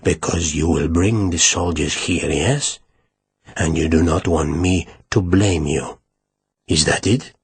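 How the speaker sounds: quietly threatens the official who betrayed him; low voice. Acted. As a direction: drop the voice right down, dangerous. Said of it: "he said in a low voice".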